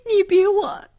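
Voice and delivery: high-pitched, wounded indignation; bitter accusation. A narrator's reading. Niece accuses her aunt of scheming, bitter and high.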